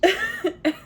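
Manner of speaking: high bright laugh; burst of laughter. Real, not acted. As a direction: laugh out loud at the scene. Laughing at Kreacher.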